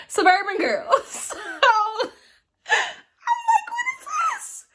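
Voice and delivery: high, squealing laughter through the words; cracking up. Real, not acted. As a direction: burst out laughing as you say it. Cracks up at her own line.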